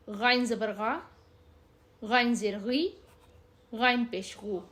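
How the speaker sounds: letter, vowel mark, then the "غ" syllable, carefully set apart; ghain drill. Shown as a demonstration. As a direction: spell out "غا، غی، غو" with voiced back-of-throat friction. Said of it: "ہم اس کی practice کرتے ہیں".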